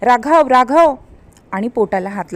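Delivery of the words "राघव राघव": raised cry for her husband on waking; startled cry of his name; calling out. A narrator's reading. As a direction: call his name out loud. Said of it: "शुद्धीवर आल्याबरोबर ती ओरडली".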